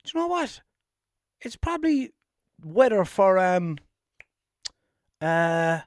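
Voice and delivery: high, light put-on thought voice; a hint of a silly inner voice; mock inner voice. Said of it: "I was thinking".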